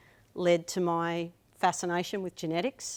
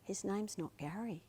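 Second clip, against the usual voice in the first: softly; 3 semitones higher, 11 dB quieter than usual. Retold or imitated. Awkward retold correction.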